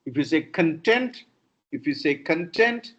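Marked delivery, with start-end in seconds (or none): 0.4-1.2 "content" as con-TENT; second-syllable stress. Shown as a demonstration. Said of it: "the meaning is different". 2.1-2.9 "content" as con-TENT; weak first vowel, stressed TENT in "content"; second-syllable stress. Shown as a demonstration. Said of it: "the meaning is different".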